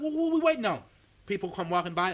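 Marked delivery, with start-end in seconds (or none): 0.0-0.8 high-pitched exasperated question; impatient partner. Retold or imitated. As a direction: imitate a restless partner.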